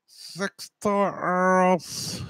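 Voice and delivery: very slow, stretched cartoon voice; cartoon voice imitation. Mimicking the caller as a cartoon, very slow. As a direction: drag it out like a cartoon character. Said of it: "heard that fucking voice before on a cartoon".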